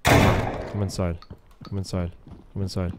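ordinary talk

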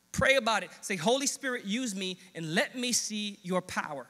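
loud, stirring exhortation to the congregation; rally them to pray, punching each phrase; full-force preaching. Acted.

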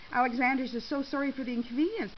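usual speaking voice

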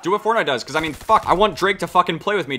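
ordinary talk

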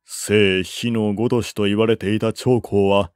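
plain reading voice

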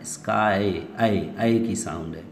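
स्काई said slowly, the y spoken as ए; teacher sounding out स्काई, then ए; showing the y sound in sky. Shown as a demonstration. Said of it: "तो कौन सी sound निकाल रहा है?".

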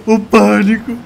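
high panicked screams; being chased. Real, not acted. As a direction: shriek in real panic. Panic while chased by trucks.

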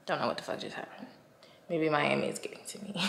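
soft unvoiced whisper; whispered aside. Real, not acted. Vlogger whispering a confused aside. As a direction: hush it to yourself.

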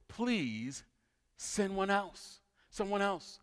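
soft, slow imitated plea; pleading. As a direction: beg quietly, stretching out the words. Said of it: "Moses again pleaded".

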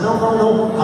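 normal talking voice

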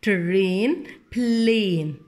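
ترين بلين with the same long "ayn" ending; matching rhyme sounds. Shown as a demonstration. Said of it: "متشابه خالص".

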